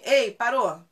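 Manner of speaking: ordem alta e seca ao cachorro; comando firme. Shown as a demonstration. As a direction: corte o latido com voz forte. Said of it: "quando você fala assim". (bronca curta e firme, em volume alto)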